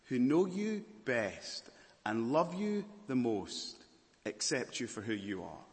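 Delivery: ordinary talk, nothing marked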